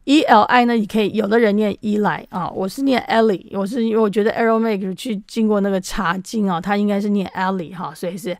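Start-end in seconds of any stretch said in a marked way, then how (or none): none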